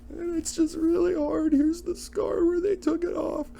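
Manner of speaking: very high, thin caricature of a woeful sister; mock-pitiful falsetto, far above normal; tiny squeaky voice.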